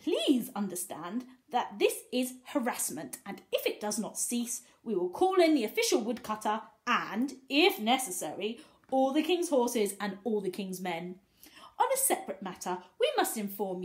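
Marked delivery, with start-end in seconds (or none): none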